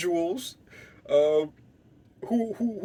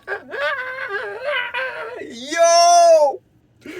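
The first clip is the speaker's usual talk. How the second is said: shrieking high manic cackle ending in a yell; wild, much higher, louder crazed laughter; maniacal laugh; 6 dB louder, much slower than usual. Retold or imitated. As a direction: laugh like you've lost your mind. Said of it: "laughing maniacally".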